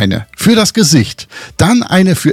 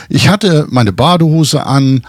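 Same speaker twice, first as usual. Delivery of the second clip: ordinary talk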